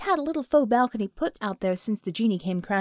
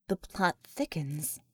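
wry aside; 7 semitones lower, 7 dB quieter, slower than usual. A narrator's reading.

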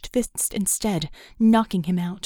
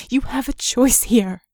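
louder, urgent plea with YOU leaned on; 6 dB louder than usual. Acted. Vicky urging Red to choose, loud and pressing. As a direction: press the choice on him firmly. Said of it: "a mere whisper".

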